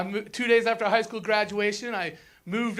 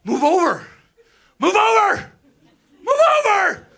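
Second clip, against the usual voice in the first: mock road rage; 7 semitones higher, 5 dB louder than usual. Road rage at slow driver.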